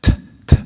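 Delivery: T as a bare puff of air; voiceless burst of breath on each T; wrong breathy T. Shown as a demonstration. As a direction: spit out an airy, aspirated T. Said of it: "That's wrong".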